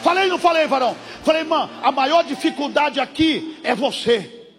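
loud, high shouted sermon; preacher rebuking fear at full force; fiery preaching. Acted. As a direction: thunder it at the congregation.

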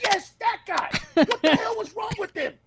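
high, incredulous comic agreement; mock exasperation. Comics agree about the audience member, in high mock exasperation. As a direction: pitch it way up, exasperated and half laughing.

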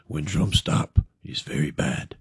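low, breathy whisper of doom; whispered omen. Acted. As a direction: hiss the warning like a secret. Second stranger repeats warning.

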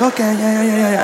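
lightly voiced nodding along; uncomprehending agreement. Masseuse who speaks no English humouring the client, uncomprehending. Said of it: "She's just like".

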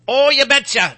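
a hammed-up Wisconsin "Oh, you betcha"; mock Upper Midwest accent. Host mocking Wisconsin talk, hammed-up Midwest accent. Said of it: "but we don't talk like this".

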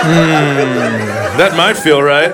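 drawn-out hum of tempted consideration; mock pondering. Comedian as boy considering couch cushions, mock tempted. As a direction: muse over it with hammed-up interest.

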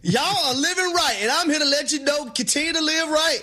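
high, slow sermon voice blessing the fans; hammed-up Sunday preacher; mock preacher. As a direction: preach to the faithful, drawn out. Co-host preaching to Knicks fans.

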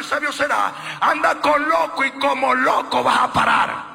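loud, slow, high preaching. Real, not acted. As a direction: hammer the warning home to the crowd. Preaching at full force. (predicación fuerte y lenta)

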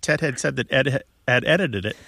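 quick, running rhythm on the tongue twister; rhythmic. Shown as a demonstration. Radio host showing a caller the tongue twister's rhythm, quick and rhythmic. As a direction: let the syllables patter along in an even beat. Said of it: "it's got a rhythm".

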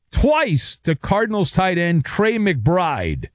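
ordinary talk, nothing marked

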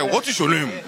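a hammed-up English "What is your name?"; mock English accent with wide eyes. Mimicking Spanish kids asking his name, in a mock English accent. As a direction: play the stiff English speaker. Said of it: "con los ojos y todo".

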